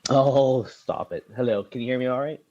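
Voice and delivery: ordinary delivery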